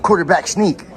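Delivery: loud, gleeful boast; excited brag. Real, not acted. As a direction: crow about getting past the guard. Brags after slipping past guard.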